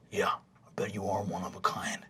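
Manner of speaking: low hushed sneer; whispered taunt. Acted. Half-orc bully mutters a jab at Fabian, whispered. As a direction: murmur the jab under your breath. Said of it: "Rog says".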